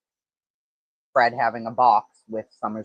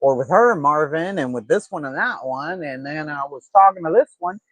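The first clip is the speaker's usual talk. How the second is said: mocking backpedal; 5 semitones higher than usual. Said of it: "he's like".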